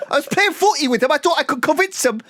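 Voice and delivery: very high put-on accent of uncertain origin; hammed-up comic accent, way up in pitch; Gaza. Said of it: "that's the accent they have".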